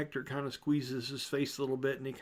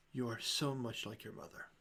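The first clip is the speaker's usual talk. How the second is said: soft farewell; 2 semitones lower, 7 dB quieter than usual. Acted. Father's parting words, softly.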